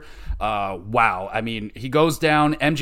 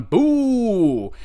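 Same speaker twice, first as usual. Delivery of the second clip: mock boo; 8 semitones higher, 3 dB louder than usual. Booing the disqualification.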